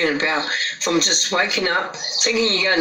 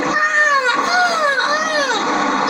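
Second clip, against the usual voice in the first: high crying scream of a woman; mock scream, pitched far up; imitated screaming; much slower than usual. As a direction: wail it high like someone in distress. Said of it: "I'm hearing somebody screaming".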